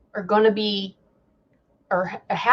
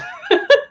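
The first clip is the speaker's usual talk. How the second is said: short laugh. Real, not acted. Laughs at lawsuit.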